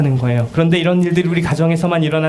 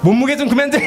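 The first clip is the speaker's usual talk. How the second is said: a higher, mock-annoyed mother scolding; nagging parent; 10 semitones higher than usual. Mother nagging her child about weighing, mock annoyed. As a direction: play the fed-up mom for laughs.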